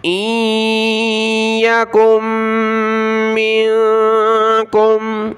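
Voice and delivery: इयकुम मिनकुम with a held nasal hum, very slow; nasal ghunna. Shown as a demonstration. Teacher demonstrates ghunna, very slow. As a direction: hum the noon and meem through the nose. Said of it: "नाक में आवाज़ पैदा करेंगे।".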